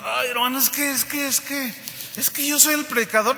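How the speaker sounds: high, halting mock plea; súplica titubeante. Suplica al portero que lo deje. (súplica fingida, aguda y titubeante)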